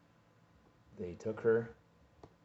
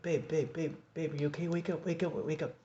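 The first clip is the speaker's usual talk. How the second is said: higher, louder calls to wake her; urgent calling; 10 semitones higher, 4 dB louder than usual. Retold or imitated. Reviving collapsed girlfriend, calling out high and loud. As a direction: call her name urgently, pitch up.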